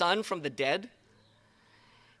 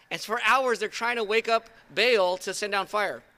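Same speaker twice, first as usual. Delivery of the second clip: usual speaking voice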